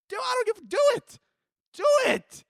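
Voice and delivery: high-pitched do it; shrill urging. Real, not acted. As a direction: egg them on with a high cry. Urging viewers to double text.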